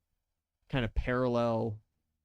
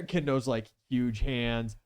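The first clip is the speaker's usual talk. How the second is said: normal talking voice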